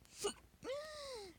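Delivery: sudden high hiccup gasp; a real sharp hiccup that cuts in; involuntary squeaky hiccup. Real, not acted. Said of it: "Fucking hiccups".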